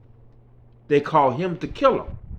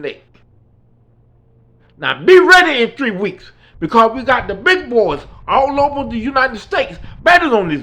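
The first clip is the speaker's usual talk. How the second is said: loud, higher, threatening tirade; menacing Klan leader; 5 semitones higher, 6 dB louder than usual. Retold or imitated. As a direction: browbeat the boy with threats.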